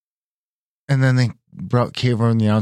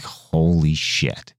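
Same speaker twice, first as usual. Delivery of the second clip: slow stunned holy shit; 4 semitones lower, much slower than usual. Retold or imitated. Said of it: "I was like".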